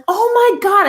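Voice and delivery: high, loud thrilled exclamation; retold excitement. Recalling first seeing the bear up close, excited. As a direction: reenact a burst of excitement. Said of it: "I was so excited at first".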